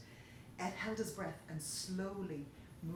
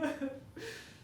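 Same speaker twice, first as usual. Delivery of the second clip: a tearful, rising laugh-sob; half laugh, half sob; crying laugh; 6 semitones higher than usual. Acted. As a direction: hysterical little sob-laugh. Baker breaking down.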